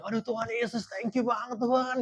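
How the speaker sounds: high-pitched acted cry of relief; imitated jubilant relief; overjoyed thanks. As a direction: thank God as if just spotting rescue. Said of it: "saya udah bilang haleluya paling kenceng".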